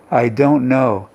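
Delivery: ordinary talk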